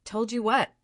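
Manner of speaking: usual speaking voice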